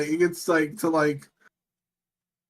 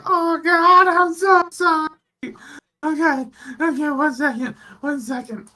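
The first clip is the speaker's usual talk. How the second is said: high, louder sung-out apology; singsong apology; 11 semitones higher, 5 dB louder than usual. Retold or imitated. Pilot apologizing again after the spill, high singsong voice. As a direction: croon the sorry in a silly high voice. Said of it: "And then he's like".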